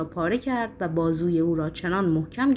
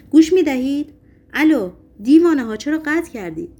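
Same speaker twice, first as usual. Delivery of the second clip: loud, high-pitched yell down the line; shouting into phone; 7 semitones higher, 7 dB louder than usual. A narrator's reading. Patient yells at police who hung up, shouting. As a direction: demand an answer at raised volume. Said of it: "دوباره در گوشی فریاد زد".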